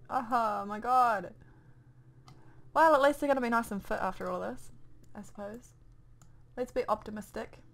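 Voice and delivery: ordinary talk, nothing marked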